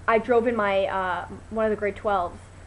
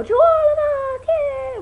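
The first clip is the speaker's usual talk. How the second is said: very high, loud group chant; shouted team cheer; 9 dB louder than usual. Shown as a demonstration. Showing viewers the cast's warm-up cheer, shouted high. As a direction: yell it like a huddle rallying cry. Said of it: "for our warm-up, we put our hand in a circle and go".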